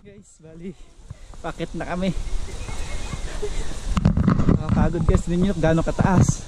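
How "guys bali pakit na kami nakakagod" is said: winded and panting after the climb; out of breath. Real, not acted. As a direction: speak between heavy breaths. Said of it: "hinihingal ako".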